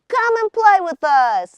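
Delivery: high, loud playful call; cheeky kids yelling from the riverbank, high and loud; shouting boys. A narrator's reading. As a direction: call out to them across the water, high and loud. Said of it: "the boys shouted".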